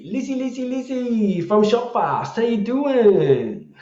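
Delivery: high, drawn-out gleeful hello; long swooping high-pitched greeting; playful sing-song greeting. Pretend. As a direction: call out to an old mate in a silly high voice. Playful calling out to old colleague.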